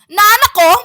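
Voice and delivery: high, loud call across the room; loud announcing. Retold or imitated. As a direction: announce your arrival to everyone. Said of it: "mo-announce dapat kapag abot nimo".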